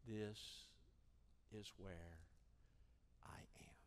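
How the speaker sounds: slow, hushed, falling away; low, quiet confession with long pauses; solemn admission. Acted. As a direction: admit it word by word, sinking very soft. Slow solemn admission before altar prayer.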